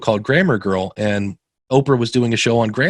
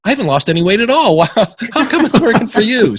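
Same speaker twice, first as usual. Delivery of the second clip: higher, quick and laughing, climbing to a squeaky top; mock disbelief; 11 semitones higher, 5 dB louder than usual. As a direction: play up comic envy through a laugh. Mock-envious reply, laugh heard.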